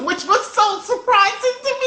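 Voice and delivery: high, laughing disbelief; surprised laughing aside; laughing in surprise. Real, not acted. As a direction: laugh through it, amazed.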